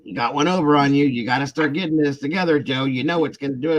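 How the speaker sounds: mock-nag the husband who got played; scolding wife. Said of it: "And then it's World War Three".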